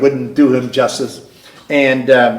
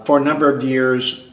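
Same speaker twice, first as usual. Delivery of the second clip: normal talking voice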